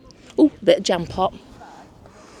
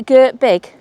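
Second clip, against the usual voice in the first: light mock Bristolian, louder and higher; Bristolian accent; 5 semitones higher, 7 dB louder than usual. Woman trying out her companion's local phrase, Bristolian accent. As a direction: attempt a Bristolian twang, with extra punch. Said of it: "Bristolian accent".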